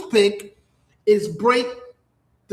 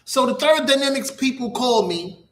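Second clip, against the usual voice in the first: usual speaking voice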